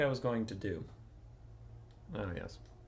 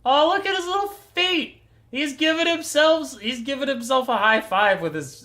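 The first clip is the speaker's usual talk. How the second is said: overwhelmed by cuteness; 14 semitones higher, 8 dB louder than usual. Real, not acted. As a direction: squeal over a cute pet. Gushing over lizard photos.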